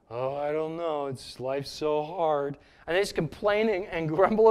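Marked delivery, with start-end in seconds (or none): 0.1-2.6 low, soft, slow, mopey donkey voice; gloomy cartoon donkey moaning; Eeyore impression. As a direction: droop every word like Eeyore.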